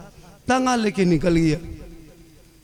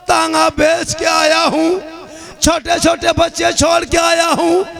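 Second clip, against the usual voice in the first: higher, louder impassioned preaching plea; preaching at full force; 10 semitones higher, 5 dB louder than usual. Acted. Poor tanga driver pleads with Garib Nawaz in full-force preaching. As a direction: cry out to the saint with full passion.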